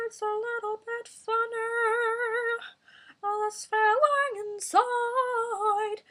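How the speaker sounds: high, slow, loud posh operatic singing; hammed-up posh lady opera; British Posh Lady. Shown as a demonstration. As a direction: sing it like a grand posh English lady. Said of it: "that's British Posh Lady".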